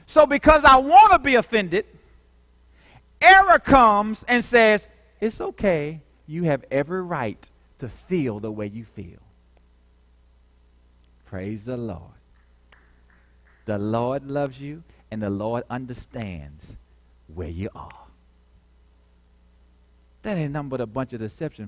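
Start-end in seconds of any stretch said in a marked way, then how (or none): 0.1-1.9 rising preacher's cry, louder; preaching loud. Real, not acted. Preacher building to a shout. 5.2-9.2 soft, lower coaxing voice of error; tempting voice. Acted. 11.3-17.9 much softer, much lower mock-pious comfort; false comfort. Error voiced as soothing tempter.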